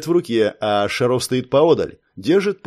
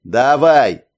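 loud; 6 dB louder than usual. Acted. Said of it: "поддакнул Тысадзе".

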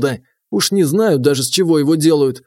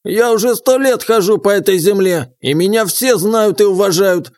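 put-on higher, proud old man boasting; old prospector; 5 semitones higher than usual. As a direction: bang the table and boast, old-timer. Old man boasting, fist on table.

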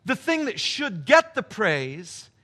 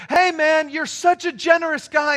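imitated homeless man calling out, higher and louder; yelling out; 6 semitones higher, 5 dB louder than usual. Homeless man calls after his generous giver, yelling out. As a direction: call it out in a raised, higher voice. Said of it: "the homeless man yells out".

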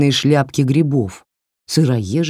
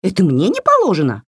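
higher, fast indignant doll; 5 semitones higher, faster than usual. Acted. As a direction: bristle like an offended little doll. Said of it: "разъярилась кукла". (возмущённый писклявый голосок куклы)

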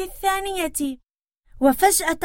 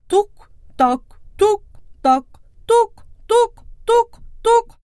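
heavy hoof knocks; 8 semitones higher, 4 dB louder, faster than usual. Acted. Big billy goat hooves.

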